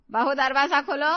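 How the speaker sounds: loud, commanding call; calling through door. Acted. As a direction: raise your voice to get the door opened.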